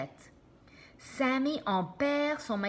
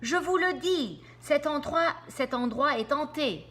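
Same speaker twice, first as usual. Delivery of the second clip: high, loud frightened insistence; frightened moan; 7 semitones higher, 4 dB louder than usual. Acted. Sammy insists in fear that the place is haunted. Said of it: "gémit Sammy".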